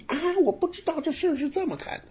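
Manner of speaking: high-pitched astonished outburst, hammed up; verbaasd geschrokken. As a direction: act out a sudden startled discovery, voice way up. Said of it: "拍案惊奇".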